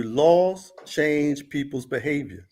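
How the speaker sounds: ordinary talk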